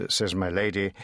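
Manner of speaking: usual speaking voice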